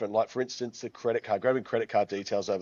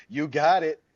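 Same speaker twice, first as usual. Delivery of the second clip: higher, louder chirpy American "You got it"; mock American accent; 7 semitones higher, 5 dB louder than usual. Australian guest imitating American waiters, bright mock American accent. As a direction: ham up an eager American server. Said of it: "if we, "Oh, can I please have a coffee?".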